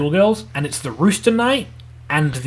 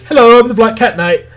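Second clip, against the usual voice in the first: higher, louder mock introduction; hammed-up greeting; 6 semitones higher, 7 dB louder than usual. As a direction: announce yourself as a cartoon character. Introducing retold anime character.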